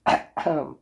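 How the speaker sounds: a short throat clear, mouth closed. Real, not acted. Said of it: "I just had to clear my throat".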